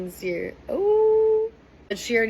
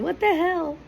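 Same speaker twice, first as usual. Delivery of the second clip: high-pitched mock outrage; emphatic; 10 semitones higher than usual. Imitating Islander who caught girls kissing her man, emphatic. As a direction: hit it hard, high and incredulous.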